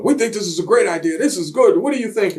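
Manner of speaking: lightly voiced boss selling an idea; mock Vince. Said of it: "he thought, you know".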